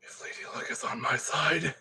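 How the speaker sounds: hushed superstitious whisper. Real, not acted. Builder whispering a wish to Lady Luck.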